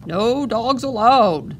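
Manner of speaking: loud, slow gruff guard voice. A narrator's reading. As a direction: bark the rule at the dogs. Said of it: "the guard said gruffly".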